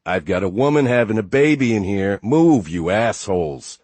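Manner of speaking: shouting out the window; loud yell. A narrator's reading. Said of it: "Kyle leaned out the window yelling".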